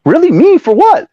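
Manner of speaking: loud, high-pitched disbelief; incredulous protest. Retold or imitated. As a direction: voice shooting up, indignant at being blamed. Acts his disbelief at being blamed.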